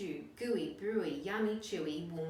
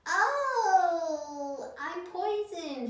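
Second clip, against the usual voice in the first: much higher, louder, slow howl; howling dingo; 12 semitones higher, 9 dB louder, much slower than usual. A narrator's reading. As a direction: wail it like a poisoned dog. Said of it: "he howled".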